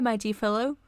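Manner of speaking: usual speaking voice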